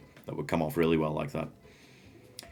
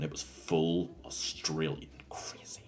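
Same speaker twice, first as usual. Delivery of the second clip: hushed; 2 semitones lower, 8 dB quieter, slower than usual. Real, not acted. Trailing into awed aside.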